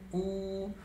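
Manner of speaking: high stretched hoot; train horn imitation. Shown as a demonstration. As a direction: pull the horn cord, hold the sound. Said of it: "A buzina, fazendo o". (buzina de trem, aguda e longa)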